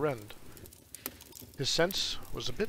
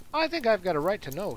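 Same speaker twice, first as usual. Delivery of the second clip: injured tone; 7 semitones higher, 6 dB louder than usual. Acted. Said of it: "he said in an injured tone".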